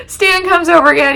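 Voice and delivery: high giggles through the words; laughing while talking. Real, not acted. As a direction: laugh your way into the next line. Laughs retelling Stan's arrival.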